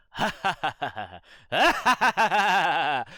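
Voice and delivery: loud, high staccato ha-ha bursts; motorcycle laughter. Shown as a demonstration. Showing the motorcycle laughter game, loud revving laugh. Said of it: "you get on your motorcycle and you go".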